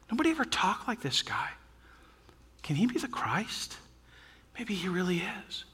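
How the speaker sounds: soft, awed realization; quietly marvelling quote; hushed wonder. Retold or imitated. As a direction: drop the volume, as if it is just dawning. Officers' dawning realization voiced.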